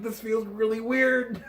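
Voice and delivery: high, loud, stretched-out wail; Spider-Man quote. Retold or imitated. Quoting Spider-Man hit by anti-gravity gun, high stretched-out mock wail. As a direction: draw it out high and loud, like someone floating helplessly.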